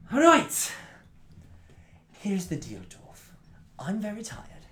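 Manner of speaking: long pauses, tired but threatening; weary, menacing intruder. Acted. Tired raider warning the dwarf he'll return, menacing.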